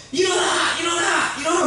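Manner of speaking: high-pitched repeated call; urgent shout. Retold or imitated. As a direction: yell out urgently to rouse someone. Someone outside calls to drowning child. (다급하게 높은 목소리로 외치기)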